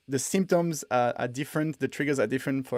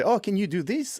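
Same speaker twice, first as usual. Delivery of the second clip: higher-pitched mock eager asker; eager request; 7 semitones higher than usual. Twitter followers asking for a demo, eager request. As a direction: imitate a keen follower's rising question. Acting out people's request online.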